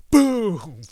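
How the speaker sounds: shouted, high explosion sound; loud mock explosion; loud boom. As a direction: yell the impact like a comic-book blast. Impact sound in retold fight.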